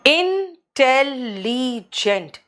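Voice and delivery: इंटेलिजेंट split slowly into four syllables; teacher counting the syllables of इंटेलिजेंट; syllables broken apart. Shown as a demonstration. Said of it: "चार है शब्द खंड इसमें".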